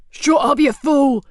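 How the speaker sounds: furious boss screaming at thug. A narrator's reading. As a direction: scream him down. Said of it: "screamed Cox".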